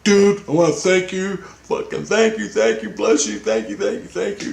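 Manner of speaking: high, overjoyed thanks pouring out; grateful gushing. Retold or imitated. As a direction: gush thanks like a relieved father. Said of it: "He goes, he goes".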